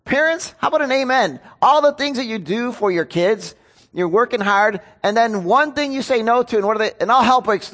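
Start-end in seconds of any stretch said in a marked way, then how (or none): none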